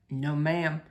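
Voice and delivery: a lightly voiced shy man; low quiet Otis. A narrator's reading. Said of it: "he said".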